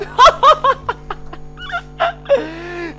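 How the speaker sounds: very high hysterical cackle; hysterical laugh. Real, not acted. Gamer after a jumpscare, shrieking laughter. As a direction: laugh helplessly at high pitch.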